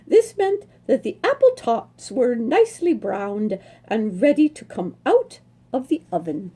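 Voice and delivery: lively read-aloud storytelling voice; story narration. A narrator's reading. Reading a horse story aloud to listeners, lively storytelling. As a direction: read it like a picture book to children, bright and lilting. Reads picture book aloud.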